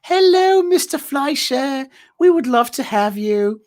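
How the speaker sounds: high, drawn-out posh British phone greeting; British accent. Retold or imitated. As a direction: put on a slow, lilting British accent, pitched well up. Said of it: "it's always a British accent".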